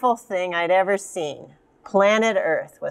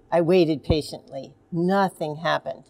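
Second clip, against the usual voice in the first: usual speaking voice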